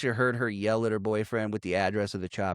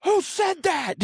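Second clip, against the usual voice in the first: very high-pitched demand; hammed-up outraged question; mock indignant shout; slower than usual. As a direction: act out a yelled challenge. Cop shouting back.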